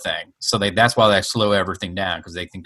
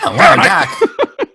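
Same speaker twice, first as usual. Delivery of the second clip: high, drawn-out boyish movie-hero impression; stunned young sidekick voice; Michael J. Fox; 6 dB louder, much slower than usual. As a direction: stretch out the astonishment, high and loud. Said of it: "you had a Michael J. Fox".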